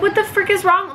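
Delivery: high, exasperated mock question. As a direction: voice the mom's baffled glare. Mom's exasperated look.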